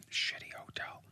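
barely audible whisper; whispered aside. Real, not acted. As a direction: whisper it under your breath. Muttered aside before repeating it.

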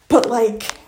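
ordinary delivery

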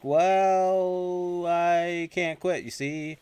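mock hedging, holding each opening word; drawn-out stalling. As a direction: draw it out as if reluctant. Retold reluctant explanation, stretched out.

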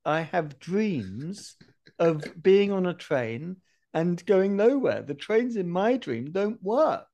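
slow, drawn-out retold speech; addressing the conductor. Dreamer solemnly telling a train conductor his dream, slow declaration. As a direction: spell it out slowly, with great weight. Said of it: "I said to the conductor".

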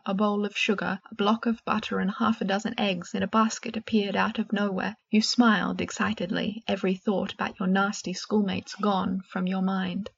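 usual speaking voice

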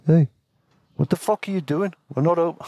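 put-on Maori Kiwi accent. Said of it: "God, Maori accent. Kiwi".

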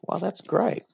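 high-pitched imitation of a pleased customer; imitated delight. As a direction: play a customer pleasantly surprised. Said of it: "Others say".

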